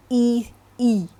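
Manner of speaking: "E, E" as two separate short vowel sounds; isolated vowel sound. Shown as a demonstration. Teacher showing students how X begins, "E" sounded out separately. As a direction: sound out a bare "E", then repeat it. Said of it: "X gives you the sound of".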